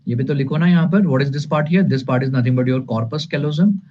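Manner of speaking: ordinary delivery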